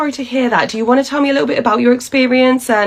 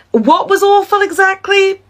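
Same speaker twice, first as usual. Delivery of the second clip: exasperated; 11 semitones higher than usual. Acted. Exasperated worker pressing the customer.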